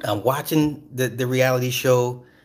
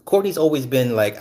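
ordinary talk

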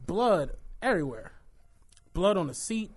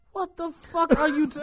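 higher, louder indignant snap; mock outrage; 9 semitones higher, 4 dB louder than usual. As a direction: cut yourself off laughing mid-rant. Arguing back at lady.

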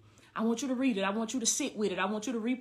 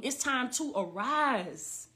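slow solemn declaration; 6 dB quieter, much slower than usual. Acted. Preacher's slow quiet declaration.

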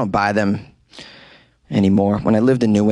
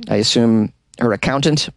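normal talking voice

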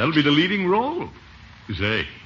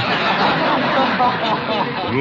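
high, rolling chuckle; long, higher amused laugh; Gildersleeve's laugh; 7 semitones higher, 3 dB louder than usual. Acted. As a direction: laugh it off smugly.

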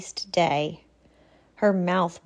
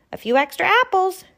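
high gentle doe; 12 semitones higher, 5 dB louder, faster than usual. Acted. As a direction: offer a gift sweetly.

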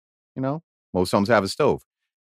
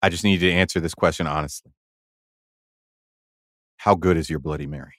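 low, hushed, with long dramatic pauses; dramatic earnest ask; 8 semitones lower than usual. Shown as a demonstration. Host showing how to ask the server, low and hushed. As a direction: lower the voice and lock eyes like it's life or death. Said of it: "and this is how you do it".

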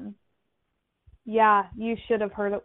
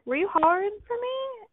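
puzzled question; 12 semitones higher than usual. Retold or imitated. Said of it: "I'm like".

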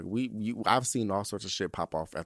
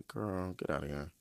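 low, soft, unimpressed mutter; low disbelieving brush-off; dismissive inner voice; 7 semitones lower, 9 dB quieter than usual. Retold or imitated. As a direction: wave him off under your breath. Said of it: "I'm over here like".

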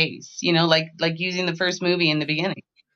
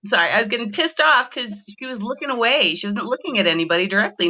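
normal talking voice

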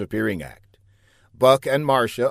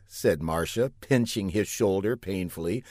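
ordinary delivery

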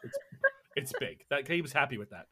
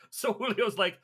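normal talking voice

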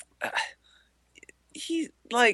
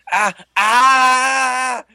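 short hammed-up yelps of pain; mock pained cries; 8 semitones higher, 6 dB louder than usual. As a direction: cry out like a man whose tendon is cut. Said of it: "He's like".

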